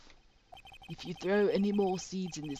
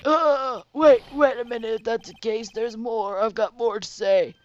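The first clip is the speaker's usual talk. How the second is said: put-on loud, pleading old man; frantic old man; 2 semitones higher, 7 dB louder than usual. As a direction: beg the court to hear him out. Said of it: "doing the voice of two old men".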